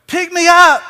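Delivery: put-on high, loud little child begging; high, loud child calling to dad; child's plea. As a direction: reach up and beg like a small child.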